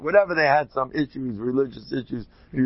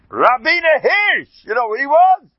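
fervent exclamation; 14 semitones higher, 8 dB louder than usual. Real, not acted. Rabbi hailing Rabbeinu Hirsch to his listeners, high, loud and fervent. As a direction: lift the crowd with ringing awe.

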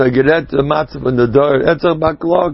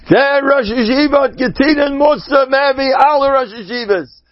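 high, sustained exclamation; emphatic quote; 10 semitones higher than usual. Retold or imitated. Companion praising the Rosh Yeshiva on the journey, emphatically. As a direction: proclaim it high from start to end. Preacher stresses a revered teacher's words.

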